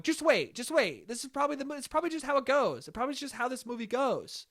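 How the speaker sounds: high, soft, hurried-sounding mom voice; imitating insistent mom. Mom telling dad to keep waiting, mock insistent.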